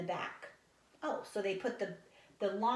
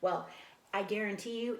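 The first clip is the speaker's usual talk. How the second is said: ordinary delivery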